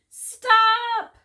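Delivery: very high, very loud shout; yelled stop. A narrator's reading. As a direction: scream it at the man. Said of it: "Tia yelled very loudly".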